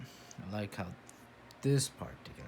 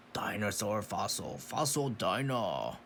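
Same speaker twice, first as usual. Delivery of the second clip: ordinary delivery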